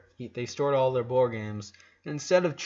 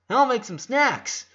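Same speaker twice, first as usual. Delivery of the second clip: put-on eager little girl; 10 semitones higher, 6 dB louder than usual. A narrator's reading. Lola's eager offer.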